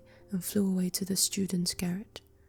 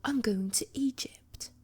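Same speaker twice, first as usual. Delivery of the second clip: higher, slow whispered cry; swallow crying out; 4 semitones higher than usual. Acted. Swallow tells the sailors it is leaving, whispered cry. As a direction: let the little bird cry out its news. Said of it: "cried the swallow".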